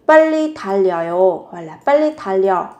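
ordinary delivery

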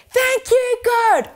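yelled out. Shown as a demonstration. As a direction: shout it out with the kids. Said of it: "could all yell out".

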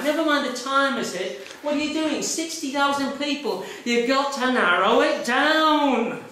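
indignant urging; insistent demand. Retold or imitated. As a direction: badger the officer to act.

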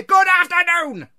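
slow, high, full-force dismissal; furious shout. A narrator's reading. Scrooge driving his nephew out, furious shout. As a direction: bellow each word to be rid of him.

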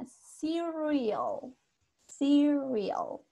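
Cereal drawn out with an untrilled R; soft English R. Shown as a demonstration. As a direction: model Cereal twice, slowly, SEE-rree-ol. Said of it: "La R no es así tan marcada".

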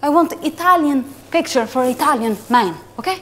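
lightly put-on Italian accent; broken English with an Italian lilt. Italian pasta boss scouting a model, Italian accent.

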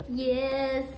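ordinary talk, nothing marked

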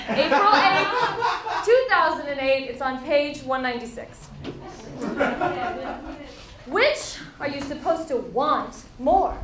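ordinary delivery

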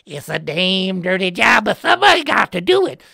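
loud, gruff blue-collar guy; a touch of a proud grimy-job guy; gruff worker. Retold or imitated. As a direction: belt it like a tough worker. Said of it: "was like".

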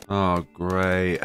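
normal talking voice

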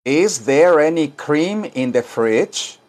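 slow, careful English; slow teaching read; slow model sentence. Shown as a demonstration. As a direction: say it slowly for learners to repeat. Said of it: "Digan esto".

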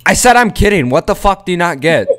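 loud, high, exasperated yell; sudden loud mock rant; mock outburst. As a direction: burst out as if fed up. Snaps at unamused strangers.

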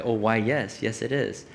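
low, soft, knowing reply; grinning. Retold or imitated. Tour guide confirming to Ezekiel there is water, grinning. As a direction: answer quietly through a grin. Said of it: "the guy's, like, grinning".

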